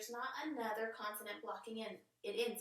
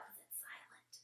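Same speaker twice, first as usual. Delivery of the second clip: hushed whisper for the silent letter; soft conspiratorial whisper; playful whisper; 17 dB quieter than usual. Shown as a demonstration. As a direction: whisper it like a secret.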